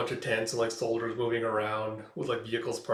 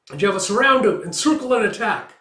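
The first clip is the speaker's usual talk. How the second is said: higher, louder battle order; shouted command; 9 semitones higher, 9 dB louder than usual. Retold or imitated. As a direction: call the order to surround him. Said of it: "sound effect caption read".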